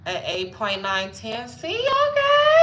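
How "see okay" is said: very high, loud, drawn-out yell; exuberant squealed shout. Pretend. Celebrating her score to viewers, exuberant squealed shout. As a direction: stretch "okay" into a long high scream.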